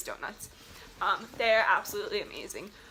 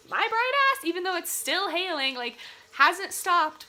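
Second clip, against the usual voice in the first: self-mocking; 7 semitones higher than usual. Real, not acted. Mocking her own foolishness.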